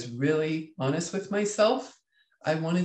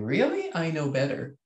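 know-it-all. Retold or imitated. How coworkers heard her, a know-it-all "Really?". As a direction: sound like you already know better. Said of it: "it looked like I was going".

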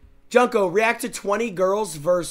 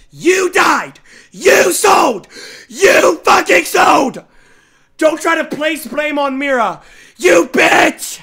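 screaming, much louder and higher; furious shout; 7 semitones higher, 9 dB louder, slower than usual. Real, not acted. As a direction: blow up at him in real rage. Raging at an annoying teammate.

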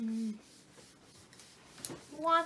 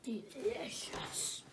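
whispered; 3 semitones lower, 7 dB quieter, much slower than usual. Real, not acted. Asked about the chocolate.

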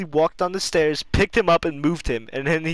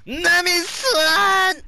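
high, slow lovestruck Sanji voice; 5 dB louder, much slower than usual. Acted. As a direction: swoon over Nami. Anime character voice, drawn out.

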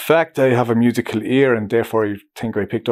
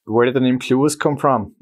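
usual speaking voice

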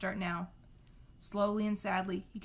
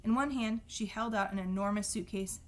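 ordinary delivery

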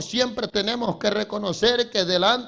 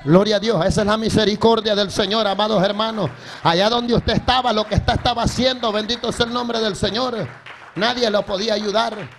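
loud, driving sermon delivery; full-force preaching; 5 dB louder than usual. Acted. Preacher praising God's mercy to the brethren, forceful preaching. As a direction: preach it out to the congregation.

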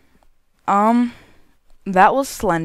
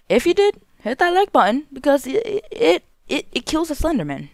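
much higher, playful plea; 9 semitones higher than usual. Real, not acted. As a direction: pitch it up playfully. Outro, joking plea to viewers.

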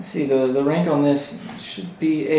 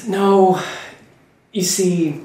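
weary sigh on a higher pitch; 5 semitones higher, much slower than usual. Acted. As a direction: sigh it out in character, with pauses. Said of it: "She sighs and says".

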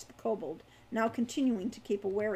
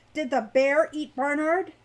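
raised, louder, higher interrogation; forceful demand; 4 semitones higher, 7 dB louder than usual. A narrator's reading. Hawk interrogating the kobold about Barnard, forcefully. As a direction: press the captive for an answer.

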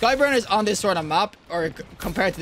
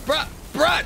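panicked shout; 9 semitones higher than usual. Real, not acted. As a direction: yelp it out in alarm. Enemy closing in on him.

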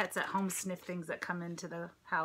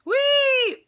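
high, loud joyful squeal; delighted shout of fun; gleeful whee; 12 dB louder than usual. Acted. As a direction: squeal like a kid bouncing. Family bouncing on couch.

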